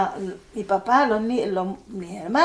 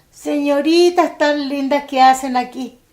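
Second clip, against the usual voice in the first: raised, louder voice of the dazed father; confused father; 5 semitones higher, 9 dB louder than usual. Retold or imitated. Father greeting his own daughters as strangers, raised and louder. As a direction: play the father who no longer knows his girls.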